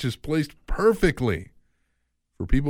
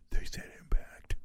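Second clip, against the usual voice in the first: whispering; 8 dB quieter than usual. Acted. Whispered aside.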